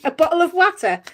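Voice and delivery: questioning demo of Sunderland accent. Shown as a demonstration. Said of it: "That's Sunderland, isn't it?".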